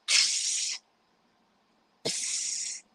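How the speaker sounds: voiced spray can psst noise; spray can hiss. Mom imitating son's spray can in bathroom, hissing.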